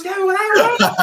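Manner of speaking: high, loud laughing call; wife calling out. Retold or imitated. Wife shouting from the bath, half laughing. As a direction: call from the bathtub, alarmed. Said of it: "She goes".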